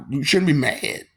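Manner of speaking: normal talking voice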